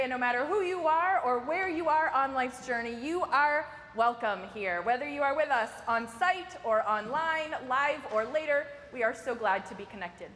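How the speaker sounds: ordinary talk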